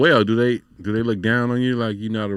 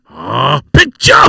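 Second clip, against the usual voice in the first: imitated gambler's yell; 7 dB louder, slower than usual. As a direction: mimic excited gamblers yelling for a card. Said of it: "so that was the thing, like".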